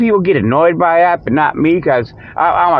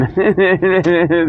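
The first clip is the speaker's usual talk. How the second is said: chuckling. Real, not acted. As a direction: run of light chuckles. Laughs at his own joke.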